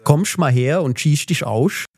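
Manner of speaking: overdone Swabian with sch everywhere, slowly; Swabian accent. Retold or imitated. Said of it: "Schwäbisch". (gespielter schwäbischer Akzent, hoch und langsam)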